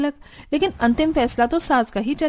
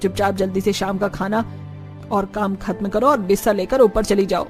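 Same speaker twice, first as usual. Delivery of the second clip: stern mother-in-law; 2 semitones lower than usual. Acted. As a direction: scold and order her about in a lowered voice. (हुक्म चलाती सख्त सास)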